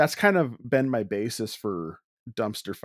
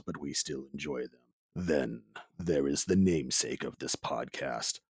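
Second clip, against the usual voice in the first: low, measured movie-trailer narrator; grand, deliberate trailer delivery, low in pitch; movie guy voice; 4 semitones lower than usual. Acted. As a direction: intone it like a film trailer voice-over. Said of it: "decided to go with my movie guy voice".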